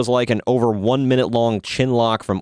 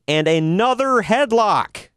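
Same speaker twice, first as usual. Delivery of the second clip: high, drawn-out, incredulous emphasis; mock exasperation; 10 semitones higher, slower than usual. Real, not acted. Podcaster recounting a tedious match, high mock exasperation. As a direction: stretch it out high, fed up with the repetition.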